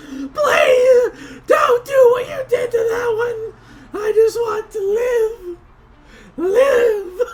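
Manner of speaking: high, loud, sobbing plea for mercy; begging goblin. Acted. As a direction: grovel and wail for your life. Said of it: "cowers before you ... and says".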